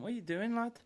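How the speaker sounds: a hint of Scouse accent, rising; mock Stones, sounding Scouse. Stones questioning the foul, a hint of Scouse accent. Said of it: "that sounded more Scouse".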